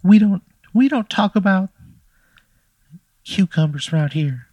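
a tiny pickle losing its temper; Bill the Dill scolding the player; angry pickle voice. Acted. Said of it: "he gets angry. And he says".

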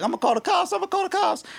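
very high-pitched mimicry of a panicky threat; mock hysterical woman. Imitating the woman who threatened two college kids, mock hysterical. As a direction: squeal it high, repeating it like a mock alarm.

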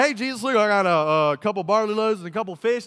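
acted shout for attention; calling out. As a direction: holler over a noisy crowd. Andrew calls out, holding the bag.

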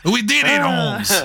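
high start, badly faked Mexican accent. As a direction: celebrate in a mock Mexican accent. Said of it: "Can I keep doing the offensive accent?".

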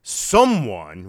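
loud, drawn-out condescending announcer; mock automated scolding; condescending recording. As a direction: lean on the word to shame a passenger. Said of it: "like, real condescending".

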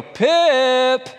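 high, loud call of "Pip!"; calling the dog. Retold or imitated. Dad calling the dog to food on the floor, loud high call. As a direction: sing out the name across the kitchen.

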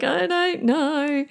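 high, drawn-out mock crying; imitated tearful teenager. Daughter asked why she is crying, tearfully imitated. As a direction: imitate a tearful girl, slow and high-pitched. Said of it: "Why are you crying".

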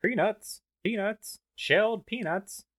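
high vendor calling peanuts; stadium vendor hawking. Pretend.

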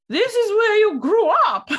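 put-on stern old English lady voice; prim, stern English granny. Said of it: "very English".

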